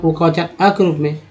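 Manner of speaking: usual speaking voice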